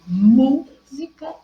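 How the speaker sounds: "música" with a long, loud MÚ; teacher sounding out "música", stressing MÚ. Shown as a demonstration. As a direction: hit and hold the first syllable MÚ of "música". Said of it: "que é o mu, que é mais forte".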